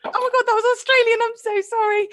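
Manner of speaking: high, squealed mock-horrified apology; retold mortified apology. Host apologising for her Australian slip, mock-mortified. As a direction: blurt it high in comic embarrassment.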